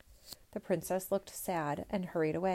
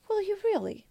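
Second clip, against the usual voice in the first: put-on much higher, louder girlish cry; eager cry; 13 semitones higher, 4 dB louder than usual. Princess begs her father to promise, high eager cry. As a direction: leap up with hope. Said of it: "cried the princess".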